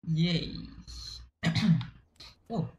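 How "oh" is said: usual speaking voice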